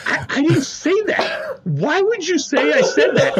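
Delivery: imitating a mom in a mild panic; panicked denial. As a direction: son mimics his flustered mom denying it, hammed-up panic. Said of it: "full panic mode".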